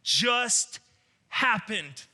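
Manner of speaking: loud, high, drawn-out disbelief; stunned wondering. Acted. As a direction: spell out your amazement loudly.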